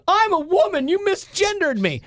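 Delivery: high-pitched mock indignation; indignant outburst. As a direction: act out an offended correction, voice leaping up. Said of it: "It's kinda like people that are like".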